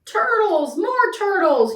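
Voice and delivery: high, loud braying call; bellowing. A narrator's reading. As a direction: bellow for them like a demanding king. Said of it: "he bellowed and brayed".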